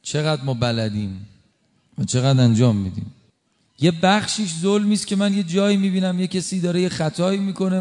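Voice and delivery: ordinary talk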